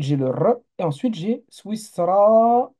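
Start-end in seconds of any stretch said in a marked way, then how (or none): 1.5-2.7 "Swisra" with the final a held long. Shown as a demonstration. Teacher sounding out the word for students, prolonged final vowel. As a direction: stretch the last a of "Swisra", higher. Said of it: "hein, A long".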